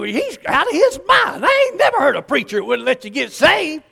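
high-pitched wifely outburst; indignant Kathy. Retold or imitated. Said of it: "She just tells you".

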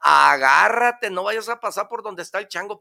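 high, urgent warning voice; gossipy animal. Acted. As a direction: rush to warn the lion. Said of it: "le dice al león".

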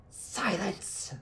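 high, harsh hiss without shouting; harsh, hollow voice. Acted. Witch silencing her pleading captive, harsh and hollow. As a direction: cut them off in an empty, hollow voice. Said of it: "the harsh voice spoke. It wasn't a shout or even very loud".

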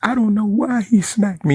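high mocking whiny boss voice; mocking the smacked boss. Mocking the boss who called the police, high whiny voice. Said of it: "the smacky".

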